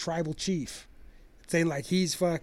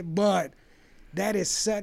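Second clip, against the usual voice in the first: ordinary talk, nothing marked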